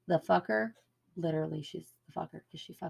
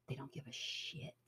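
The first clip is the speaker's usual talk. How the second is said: whispered; 4 semitones lower, 15 dB quieter than usual. Real, not acted. Aside after rant.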